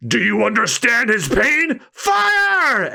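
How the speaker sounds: high voice rising into a shouted order; villain shouting fire at the soldiers. Acted. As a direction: bark the command to shoot. Spandam orders the shooting.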